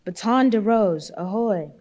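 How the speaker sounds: loud hail across the water; bawled hail. A narrator's reading. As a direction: bawl it out to the other ship. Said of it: "he bawled".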